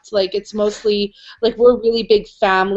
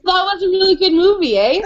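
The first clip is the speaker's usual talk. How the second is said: higher-pitched, quick Canadian sample line; Canadian everyday speech, pitched up; 9 semitones higher, faster than usual. Shown as a demonstration. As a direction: demonstrate a Canadian "eh?" tag question, quick and high. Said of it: "We legit say it".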